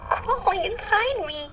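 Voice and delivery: creeping horror rising in the voice; frightened. Acted. Rising panic describing bees.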